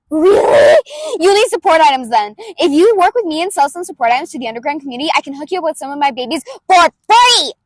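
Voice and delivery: put-on brash inventor girl, throaty and loud; loud brutal May voice. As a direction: bulldoze him with gleeful energy. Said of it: "I do it in the back of the throat, and I do it loud".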